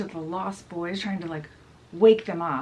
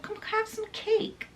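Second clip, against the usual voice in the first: mock yell; 13 semitones higher, 4 dB quieter than usual. Peter invites his fairy to eat, high mock yell. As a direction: holler in miniature, high and light. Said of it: "yells out to her".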